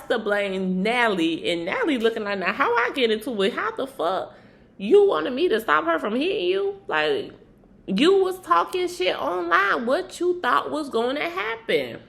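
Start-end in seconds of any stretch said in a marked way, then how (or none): 2.4-12.0 mock angry comeback. Natalie retold snapping back at Biggie.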